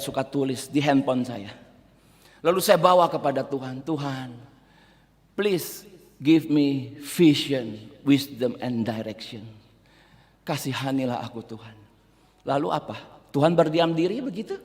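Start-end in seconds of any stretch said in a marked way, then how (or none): 3.9-11.8 soft, slow, lower plea to God; humble murmured prayer; hushed pleading prayer. Retold or imitated. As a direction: pray it quietly, almost under the breath. Preacher voices pleading prayer.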